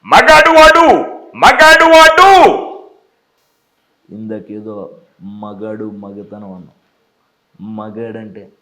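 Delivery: movie villain swinging from low menace to roars; hammed-up film villain confrontation; Sampath as Mirchi villain. Shown as a demonstration. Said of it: "ఆయన ఎలా మాట్లాడతారంటే".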